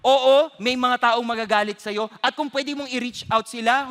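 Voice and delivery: ordinary talk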